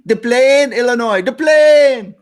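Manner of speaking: high, loud announcer call of Des Plaines; announcer shout. Shown as a demonstration. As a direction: belt it out like a stadium announcer. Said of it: "No, you're doing it wrong".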